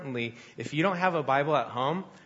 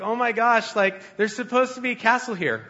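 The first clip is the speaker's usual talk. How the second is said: flustered; 8 semitones higher, 4 dB louder than usual. Retold or imitated. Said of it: "And I'm just like".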